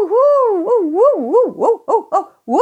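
bouncing high hoots on landing; high whooping hoots. Acted. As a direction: hoot in quick high bursts. (hohe hüpfende Jubelrufe)

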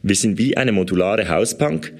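ordinary delivery

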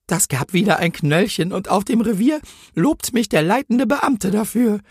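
high-pitched penitent policewoman in verse; pleading rhymed confession. A narrator's reading. Policewoman pleads to heaven in verse, high and remorseful. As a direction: lament in high, rhythmic rhyme. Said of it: "flehte den Himmel an".

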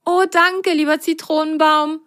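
high, bright grateful thanks; high happy little voice. Acted. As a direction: thank the tree joyfully. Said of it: "freute sich Apfelgrün".

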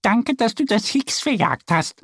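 a glad thank-you from a small duck; happy duck voice. A narrator's reading. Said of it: "sagt sie freudig".

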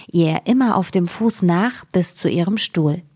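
ordinary delivery, nothing marked

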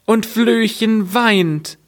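high, lamenting little door voice; high door voice, slowing at the end; plaintive door. Acted. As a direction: wail the woes as a creaky door. Door lists the woes.

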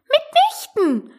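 very high, drawn-out indignant mouse; decided, very annoyed. A narrator's reading. Mouse contradicting Alice, very crossly. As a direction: refuse firmly in a squeaky voice. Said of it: "entschieden und sehr ärgerlich".

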